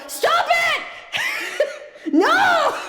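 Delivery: high, laughing shriek of protest; high-pitched protest through laughter; playful protest shout. Real, not acted. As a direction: squeal it out, breaking into laughter. Mock-pleading with gifting viewers.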